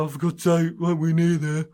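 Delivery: self-mocking whinge; exasperated moan. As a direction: huff like you're lost on the walk. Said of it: "started to moan".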